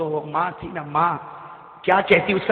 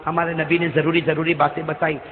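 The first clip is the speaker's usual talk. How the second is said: usual speaking voice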